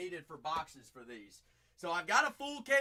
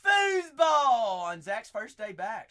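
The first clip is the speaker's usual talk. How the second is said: loud, slow, stretched hype call; hyped product shout; excited shout; 4 semitones higher, 12 dB louder, slower than usual. Real, not acted. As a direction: sell it loud and slow like an announcer. Hyping the new release.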